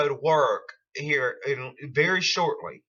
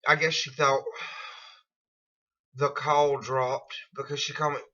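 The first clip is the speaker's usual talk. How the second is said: ordinary delivery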